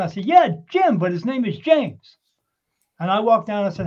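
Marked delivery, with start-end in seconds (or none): 0.2-2.2 calling loud and high down the bar; yelled down the bar. Retold or imitated. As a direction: shout it across a room, stressing James slowly. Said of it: "yelled down".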